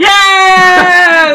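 long, high shouted cheer; triumphant cheer. Real, not acted. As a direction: yell it out in victory. Cheering the search contest win.

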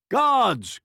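high, loud royal summons; king hollering for help; calling out. A narrator's reading. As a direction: call urgently for the guards. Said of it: "called the king".

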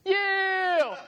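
high, drawn-out yeah; tough guy reply. Retold or imitated. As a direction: answer like a challenge. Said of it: "he's like".

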